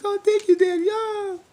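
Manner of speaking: mock him in a silly high voice; high sugary falsetto, hammed-up gratitude; mock grateful son. Mocking Chad's grateful reply.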